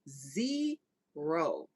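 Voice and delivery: ZERO stretched into two slow beats; emphatic ZERO. Real, not acted. Fan rating an album's skips, emphatic ZERO. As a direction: draw out both syllables of ZERO.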